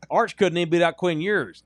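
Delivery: mock the pundit line loudly; mocking quote. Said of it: "that stupid Steve Spurrier thing".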